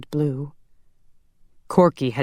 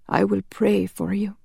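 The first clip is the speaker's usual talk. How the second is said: higher, frail gentle voice; dying priest; 6 semitones higher than usual. A narrator's reading. Priest answers Connor's confession, frail.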